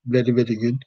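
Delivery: put-on Indian-accented catchphrase; mock Indian accent. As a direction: deliver it as a comic hook in an Indian accent. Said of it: "my whole hook was".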